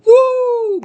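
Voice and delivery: high-pitched "woo" cheer; excited whoop. Real, not acted. Host cheering on the movies with his live chat, excited whoop. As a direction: let out a high, celebratory woo.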